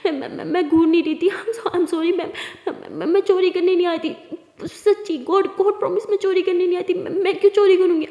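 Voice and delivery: high, loud stammering plea; panicky pleading. Acted. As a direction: beg to be believed, voice climbing. Tara pleads frantically.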